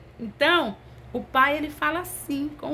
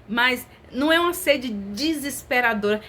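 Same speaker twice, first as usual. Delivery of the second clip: ordinary talk, nothing marked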